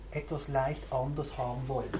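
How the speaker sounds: ordinary delivery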